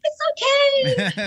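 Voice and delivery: very high, drawn-out singsong; hammed-up cheery "all fine" through a big smile; slow, squeaky-high mock reassurance; smiling reassurance. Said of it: "like, smiling".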